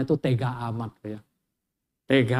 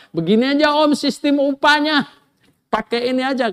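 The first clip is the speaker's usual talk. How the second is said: higher, louder, eager nephew; eager proposal; 12 semitones higher, 7 dB louder than usual. Retold or imitated. Yakub pitching the wage deal to his uncle, eagerly.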